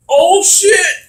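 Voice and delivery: high, loud cry of sudden alarm, hammed-up; mock alarmed yell. Retelling the villain's fall from the window, mock panicked shout. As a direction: yell it as the villain losing his grip.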